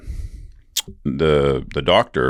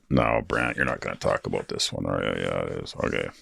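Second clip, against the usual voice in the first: muttering to himself, much softer and lower; quiet aside; 9 semitones lower, 9 dB quieter than usual. Real, not acted. Talking himself out of the story, much softer and lower. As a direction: drop to a private murmur to yourself. Self-talk aside, dropping voice.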